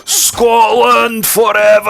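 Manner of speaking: high, loud, drawn-out war cry; Scottish battle cry. Acted. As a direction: bellow it as a Scottish patriot. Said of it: "Is [name] just Scottish?".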